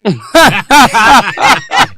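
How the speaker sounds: loud high cackle; high laughing burst; high burst of laughter. Real, not acted. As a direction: crack up at the twist.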